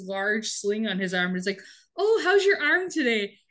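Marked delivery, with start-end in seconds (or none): 2.0-3.4 high-pitched mock sympathy; fake concern. [name] noticing the sergeant's sling, falsely concerned. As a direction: feign sweet innocence, pitched well up.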